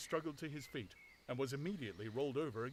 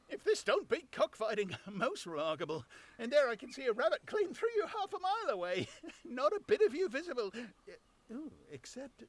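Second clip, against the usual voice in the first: high, loud, laughing tramp voice; high, giggling, astonished tramp; amazed tramp; 12 semitones higher, 5 dB louder than usual. Acted. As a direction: marvel out loud and chuckle. Tramp voice held.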